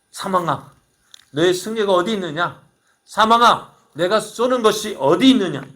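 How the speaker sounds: mock death to its face; taunting death. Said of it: "사망을 향해서 조롱합니다".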